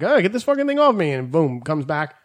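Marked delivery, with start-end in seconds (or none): 0.0-1.1 high, fast retold protest; retold mild panic. Retelling a bird clinging mid-tennis, high flustered protest. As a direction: rush it out higher, mock-flustered. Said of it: "I'm like".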